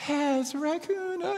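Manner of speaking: very high-pitched imitation of thrilled children; excited kids. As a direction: squeal it like a kid calling dad. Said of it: "they're all excited to see a raccoon".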